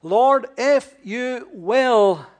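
a leper begging, drawn out; pleading leper. Retold or imitated. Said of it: "the question he asked".

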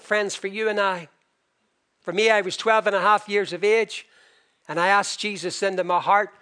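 ordinary talk

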